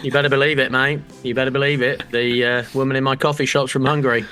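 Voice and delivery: normal talking voice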